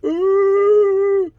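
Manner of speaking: loud, high sustained "ooh"; imitated spirit chatter. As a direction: imitate the clamour with one high held note. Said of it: "they're not arguing, but it's just like".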